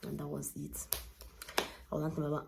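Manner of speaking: ordinary delivery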